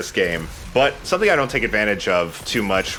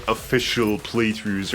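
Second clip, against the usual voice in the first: slow, mock-formal air quotes; slower than usual. Real, not acted. Gamer air-quoting his own playthrough rules. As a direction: set the phrase apart with sarcastic air quotes. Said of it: "for, like, quote".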